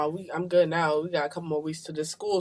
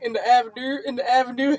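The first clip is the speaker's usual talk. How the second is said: higher-pitched mock Baltimore accent; 5 semitones higher, 4 dB louder than usual. Retelling a music video to her cohost, mock Baltimore accent. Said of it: "The Baltimore accent thing".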